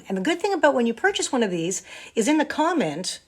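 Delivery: normal talking voice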